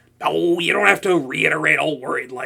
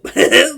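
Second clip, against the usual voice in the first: a bright embarrassed laugh; sheepish laugh; 5 semitones higher, 8 dB louder than usual. Acted. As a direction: laugh it off, caught out. Laughs at own mistake.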